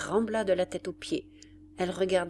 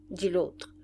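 plain reading voice

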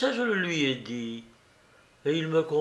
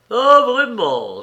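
loud, high, drawn-out mock amazement; sarcastic cry; ironic; 9 semitones higher, 9 dB louder, slower than usual. A narrator's reading. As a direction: exclaim it slowly, dripping irony. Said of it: "s'écria Rakitin avec ironie".

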